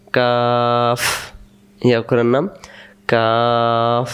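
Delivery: কাফ with air released at the end of the word; teacher shows students how to say কাফ; kaf with breath. Shown as a demonstration. কাফ, শেষে বাতাস বেরিয়ে. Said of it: "শেষে বাতাস বেরোবে".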